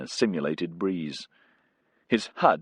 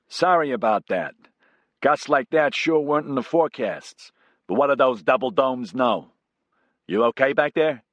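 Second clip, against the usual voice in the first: lightly voiced brash American pilot, raised; cocky pilot calling back to the passenger, a bit higher; Casey the pilot; 6 semitones higher, 3 dB louder than usual. Pilot Casey joking to Bisesa over headset, breezy.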